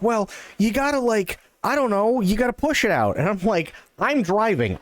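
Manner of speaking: high, exasperated back-and-forth; flustered arguing. Retold or imitated. Arguing how to get the owl out, high flustered exasperation. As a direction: pitch it up, helpless and flustered.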